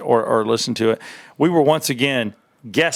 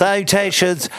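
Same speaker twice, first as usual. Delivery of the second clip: formal greeting; 7 semitones higher, 3 dB louder than usual. Acted. Mock formal sign-off echoed.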